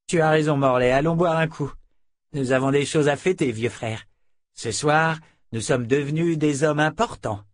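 rally your friend to drink; boastful henchman. A narrator's reading. Fitch cheering Morlaix toward the bottles, loud and triumphant.

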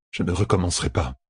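subdued, faintly wavering promise; almost quavering. A narrator's reading. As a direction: promise weakly, with a touch of quaver. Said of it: "d'une voix presque chevrotante".

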